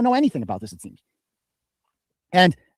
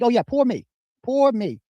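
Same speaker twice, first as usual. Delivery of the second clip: higher-pitched mocking poor-me voice; pitched-up sarcastic whine of self-pity; 7 semitones higher, slower than usual. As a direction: mimic someone feeling sorry for himself. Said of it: "look at his response to it".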